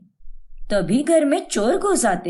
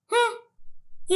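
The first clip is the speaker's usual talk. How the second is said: drawn-out narrator hum; 8 semitones higher, 6 dB quieter, much slower than usual. A narrator's reading. Narrator's long musing hum.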